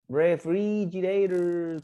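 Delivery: very slow, elongated "Refrigerators"; pronunciation model. Shown as a demonstration. Teacher urging students to practice, very slow modelling. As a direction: stretch each vowel of "Refrigerators" for copying.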